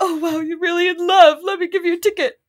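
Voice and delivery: high-pitched, over-moved gushing; hammed-up swooning at someone's romance; mock gushing. Ticket clerk melting for a man in love, mock gushing.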